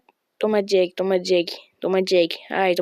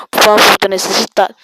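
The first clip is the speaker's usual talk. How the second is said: loud begging; 9 dB louder than usual. Pretend. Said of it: "begging for subscribers".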